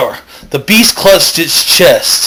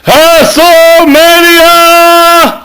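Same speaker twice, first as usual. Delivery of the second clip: slow, high drawn-out howled cry; wild howl; 14 semitones higher, 5 dB louder, much slower than usual. Acted. The werewolf howls mid-transformation, a slow wild cry. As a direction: howl it out as the wolf. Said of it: "sorry, I lost it there".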